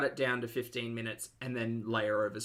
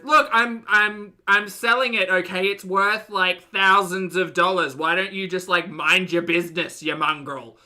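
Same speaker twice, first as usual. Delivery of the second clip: loud, raised-pitch indignant protest; acted exasperated neighbour; very upset; 5 semitones higher, 8 dB louder than usual. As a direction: defend yourself crossly to the camera. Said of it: "John's very upset".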